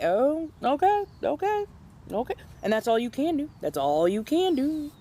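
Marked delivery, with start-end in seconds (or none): 0.0-2.3 surrender with a sigh in the voice; resigned giving in. Retold or imitated. Barista giving up and agreeing, mock resigned.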